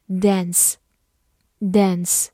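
"dance" the American way, with a front æ vowel; American pronunciation. Shown as a demonstration. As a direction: say "dance" in American English, dæns, not dahns. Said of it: "美式发音读作". (dance美式发音，读作dæns)

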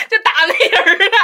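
high bursts of real laughter; giggling through the words; laughing. Real, not acted. Laughing at gossip about fight.

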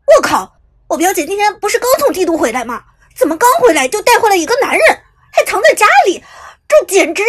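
very loud, high, racing freak-out; losing it completely, near tears; frantic. A narrator's reading. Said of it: "结果男生一听就疯了". (抓狂地大喊)

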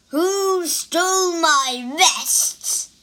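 loud, high, slow demon shout. Acted. Demon shouts at the thieves, angry shout. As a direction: boom it across the room. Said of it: "a voice shouted".

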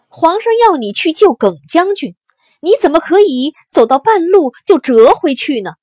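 loud, high-pitched angry confrontation; roaring accusation, high and loud; furious shouting. A narrator's reading. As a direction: shout your outrage at the general. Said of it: "怒气冲冲地跑来见他，大吼大叫".

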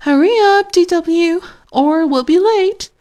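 loud, high call up the stairs. A narrator's reading. Mother calling DW downstairs, loud high call. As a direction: call her down, hurry her. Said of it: "called Mother".

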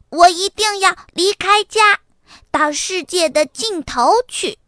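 high, determined little-fox voice; loud declaration. A narrator's reading. Little fox vowing to leave home, loud and bold. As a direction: proclaim it loudly like an eager child. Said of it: "小狐狸大声说".

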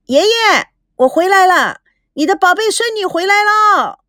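high, eager call to grandpa; excited call. A narrator's reading. Girl calling grandpa home, high and eager. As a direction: call into the house for him.

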